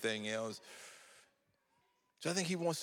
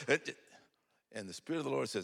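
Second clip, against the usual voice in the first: normal talking voice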